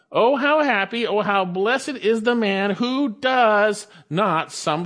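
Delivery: high, forceful "Oh, how happy, oh, how blessed"; emphatic exclamation. Shown as a demonstration. Preacher stressing the psalm's opening, "Oh, how blessed" proclaimed emphatically. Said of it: "is actually emphatic".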